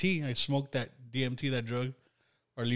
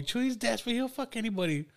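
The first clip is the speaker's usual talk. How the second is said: high mocking voice; 9 semitones higher, 3 dB quieter than usual. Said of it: "you were talking shit about me".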